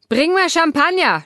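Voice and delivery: high, loud barked order; snapping. Acted. Grumpy Martin snaps at Ella for champagne. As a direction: snap it at her like a grumpy patient. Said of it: "schnauzt er mich an".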